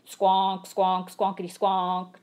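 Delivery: loud honking squonk; loud honking horn; tooting taxi horn. A narrator's reading. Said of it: "tooted a taxi horn".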